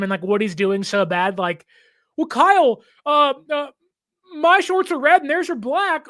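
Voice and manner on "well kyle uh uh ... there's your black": higher, eager teammate voice begging for notice; mock eager player. Backup quarterback pesters coach Kyle for attention, eager and high. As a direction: play the needy backup fishing for the coach's attention. Mocking Mac Jones seeking attention.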